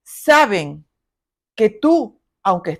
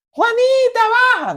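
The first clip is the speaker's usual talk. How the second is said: high shouted call upstairs; yelling for child; 12 semitones higher, 3 dB louder than usual. Retold or imitated. Mother calling daughter downstairs, high yelling call. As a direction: call her down from far away. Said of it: "tú no tienes que gritar".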